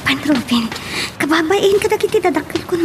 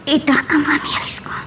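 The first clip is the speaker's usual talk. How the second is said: soft whispered apology; barely voiced, ashamed whisper; 2 semitones higher, 6 dB quieter than usual.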